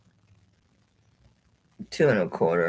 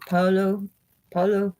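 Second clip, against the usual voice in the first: Paolo said with a higher, rolled Italian lilt; mock Italian Paolo with a flourish; playful Italian-accented Paolo; 7 semitones higher than usual. Shown as a demonstration. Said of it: "like my, my Italian accent".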